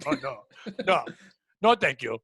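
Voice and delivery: put-on low Eastern European accent refusal; dismayed no, in a Dracula-like Eastern European accent. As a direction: imitate the coworker declining in a hard Eastern European accent. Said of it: "a very hard accent".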